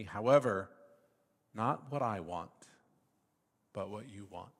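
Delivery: measured reading of the Gospel passage; scripture reading. Acted. Preacher reading Gethsemane to the congregation, measured and solemn. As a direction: read the Gospel story with weight.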